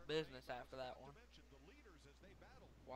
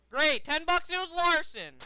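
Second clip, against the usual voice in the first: louder, higher, exasperated; angry shout; 9 semitones higher, 14 dB louder than usual. Real, not acted. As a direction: bark it out in frustration. Angry guess about a wreck.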